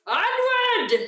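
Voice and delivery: high-pitched mock battle cry; rallying cry. Rider astride Lance Junior setting off, high rallying cry. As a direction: call the charge in a comically high voice. Player calls out astride mount.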